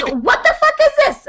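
high, outraged mom voice; imitating mom. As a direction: mom demanding answers. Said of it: "She goes".

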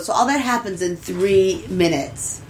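normal talking voice

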